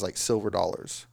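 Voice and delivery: normal talking voice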